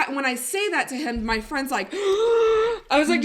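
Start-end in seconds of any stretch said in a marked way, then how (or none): 1.9-2.9 gasp. Retold or imitated. Friend gasps at her question.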